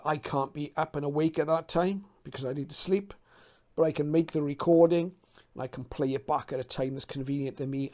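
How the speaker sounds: ordinary talk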